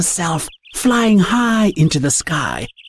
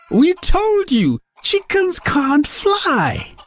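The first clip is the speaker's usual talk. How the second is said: taunting; 11 semitones higher, slower than usual. Acted. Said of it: "laughed out loud".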